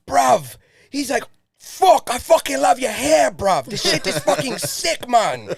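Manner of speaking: 1 London lad voice. Retold or imitated.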